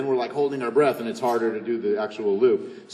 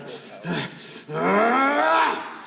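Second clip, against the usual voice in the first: long rising frustrated sigh; 10 semitones higher than usual. Shown as a demonstration. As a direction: let the frustration build in a sigh. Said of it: "So like a".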